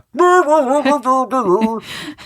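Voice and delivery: high, numb-mouthed Swedish chef babble; Swedish chef gibberish. Retold or imitated. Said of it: "Swedish chef in this guy".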